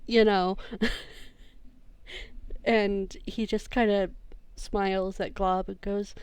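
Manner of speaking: ordinary talk, nothing marked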